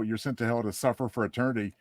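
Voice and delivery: usual speaking voice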